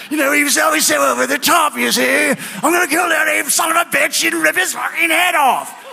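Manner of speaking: high, loud, over-the-top actor's bellow; Richard Harris impression. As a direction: ham it up as a shouting, over-the-top movie star. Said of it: "doing my impression of Richard Harris".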